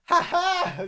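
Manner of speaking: high, loud mock laugh in bursts; Tom Cruise meme laugh. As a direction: cackle it like the famous meme laugh. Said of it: "You know that meme of him laughing".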